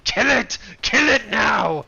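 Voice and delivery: high loud shout. Retold or imitated. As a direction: scream the order. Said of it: "I'm just like".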